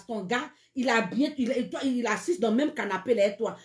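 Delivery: normal talking voice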